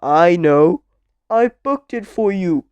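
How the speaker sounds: loud, emphatic storybook character; lightly voiced character, projected firmly; Mister Clever. A narrator's reading. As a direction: read the character's line out loudly. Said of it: "said Mister Clever".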